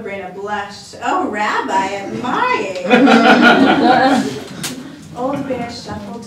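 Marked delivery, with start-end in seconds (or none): 1.0-2.8 put-on louder, bashful old lady; a flattered old woman, embarrassed; flustered grandmother. Baba Brana blushed. 2.9-5.1 put-on loud giggling old woman laugh; a loud flustered giggle; embarrassed laugh.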